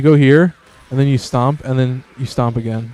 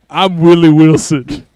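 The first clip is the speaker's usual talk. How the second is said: lightly voiced character intro, a bit louder; Willie Wilson; 3 semitones higher, 5 dB louder than usual. Character introduces himself, a light folksy voice. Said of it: "That's his name".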